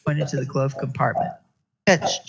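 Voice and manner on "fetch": sharp command to fetch; curt order to fetch. A narrator's reading. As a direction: bark it like an order to a dog. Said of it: "she ordered".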